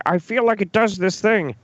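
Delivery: high-pitched parody of vague claims; mocking imitation. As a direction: mimic him in a high put-on voice. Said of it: "You can't just be like".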